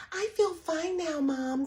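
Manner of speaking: imitating a falsely sweet, innocent girl; sweet daughter voice. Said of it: "and she sounds so sweet".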